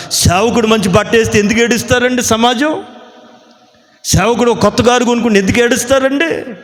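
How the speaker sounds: high-pitched indignant questioning; exasperated preacher's rhetorical question; indignant protest. Real, not acted. Preacher protests at envious critics.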